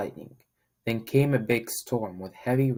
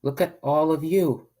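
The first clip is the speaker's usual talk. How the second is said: higher, louder, delighted; joyful; 6 semitones higher, 5 dB louder than usual. Acted. Mama Duck admiring her new ducklings, joyful. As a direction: beam at your new babies. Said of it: "said Mama Duck with joy".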